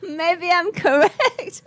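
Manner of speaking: high, slow, laughing through the words. Real, not acted. Told her pronunciation is right, she laughs delightedly. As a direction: giggle it out, delighted.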